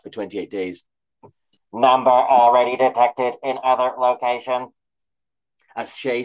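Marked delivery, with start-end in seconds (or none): 1.7-4.7 loud mechanical announcement; a machine raising the alarm; robot alarm voice. A narrator's reading. Sentinel announcing alert.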